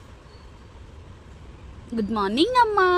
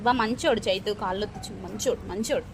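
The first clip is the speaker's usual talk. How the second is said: soothing coax; 7 semitones lower, 5 dB quieter than usual. Real, not acted. Soothing crying child.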